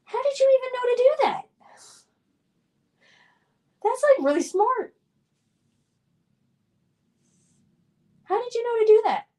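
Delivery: high, fast, excited disbelief; real excited astonishment; amazed. Real, not acted. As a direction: marvel at the viewer's cleverness. Amazed at chat's toy-soldier story.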